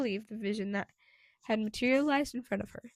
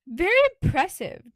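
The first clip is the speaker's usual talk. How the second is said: drawling; 7 semitones higher, 14 dB louder than usual. A narrator's reading. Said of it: "drawled a familiar voice".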